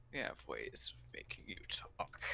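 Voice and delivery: low menacing whisper; cool foreign spy menace. Pretend. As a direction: whisper the threat like a spy villain. Whispered villain threat.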